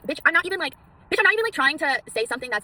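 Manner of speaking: worked-up, rapid comeback; indignant clapback. Retold or imitated. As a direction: fire back at a hater in theatrical outrage. Acted clapback to hate commenters.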